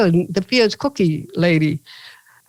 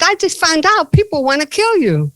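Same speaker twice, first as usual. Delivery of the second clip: alarmed high retort; 8 semitones higher, 3 dB louder than usual. Retold or imitated. Scolding Bhutto about death threats.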